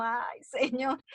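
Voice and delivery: soft, slow, smiling disbelief; a little shy laugh in the voice; incredulous smile. Retold or imitated. A woman telling the Lord she cannot believe it, with an incredulous smile. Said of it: "con esa sonrisa incrédula".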